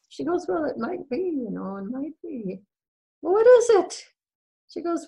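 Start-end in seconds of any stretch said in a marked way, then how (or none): 0.5-2.6 high, softening, evasive reply; reluctant hedging. Acted. Wife dodging her worried husband's question, reluctant. Wife hedges about bad news. 3.2-4.0 high, loud, exasperated question; impatient demand. Acted. Husband pressing his wife for the news, impatient.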